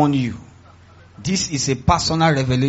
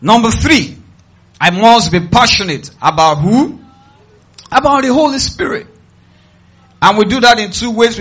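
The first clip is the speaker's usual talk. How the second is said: raised, high preaching call; loud proclamation; 6 semitones higher, 7 dB louder than usual. Real, not acted. Preacher announcing the third point to the congregation, loud proclamation. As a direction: announce it forcefully to the crowd.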